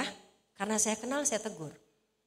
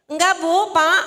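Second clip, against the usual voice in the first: loud, higher call to the crowd; raised-voice prodding of a slow audience; prompting the congregation; 4 semitones higher, 11 dB louder, slower than usual. Shown as a demonstration. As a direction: call out the answer they should give. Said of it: "Susah banget sih jemaat di sini".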